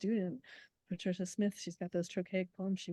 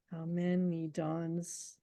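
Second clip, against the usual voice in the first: very slow, stressing many; scanned meter; much slower than usual. Shown as a demonstration. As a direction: stretch how many dawns with the weight on MANY. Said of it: "It's like, it's an iamb".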